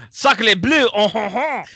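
high, loud mock French exclamation; french chef. Mock French fan reaction.

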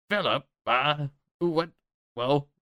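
high, squeaky goblin voice. Acted. Goblin guard questioning Philip about the prisoner, high and squeaky. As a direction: push the pitch way up, a scrappy goblin. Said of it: "he says".

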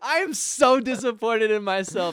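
high, loud, laughing disbelief; cracking up at the pun. Real, not acted. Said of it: "How did you not get that".

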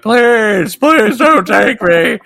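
high, loud slow pleading; hammed-up terror. Dead man begging the spirits, mock terrified. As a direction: beg like a doomed man. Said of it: "he was scared to death".

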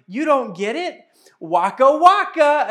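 high and loud, drawn out slowly; daughter's punchline. Retold or imitated. Daughter repeats her joke's ending to dad, high slow loud. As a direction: sing out the punchline, slow and triumphant. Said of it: "And she goes".

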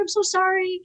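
high-pitched imitated gushing apology; mock super apologetic. Opponent apologizing after the knee injury, super apologetic. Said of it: "super apologetic".

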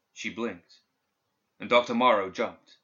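usual speaking voice

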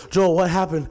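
loud, high startled question; retold startled alarm. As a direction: blurt it out as if scared by a sleeper's shout. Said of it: "I get so scared".

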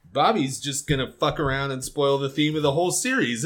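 ordinary talk, nothing marked

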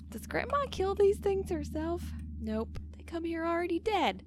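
high, put-on movie character voice; quoting movie dialogue. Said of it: "I'll just quote the whole thing".

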